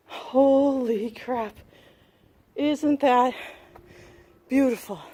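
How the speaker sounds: loud, slow amazed exclamation; excited. Real, not acted. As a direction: burst out in awe at the view. Awed burst at the view.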